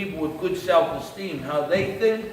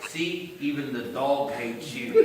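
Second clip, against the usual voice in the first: slow, low jibe in a devil voice; inner devil; 4 semitones lower, 7 dB quieter than usual. Acted. Devil mocking him about the dog, low jibe. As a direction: rub it in slowly and low as his devil. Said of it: "my devil will go".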